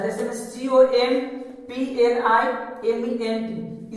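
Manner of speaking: spelled out slowly, letter by letter; spelling out letters. Shown as a demonstration. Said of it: "spelling जो है से उसका miss रहता है".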